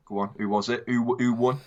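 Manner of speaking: normal talking voice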